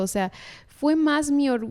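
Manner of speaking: ordinary talk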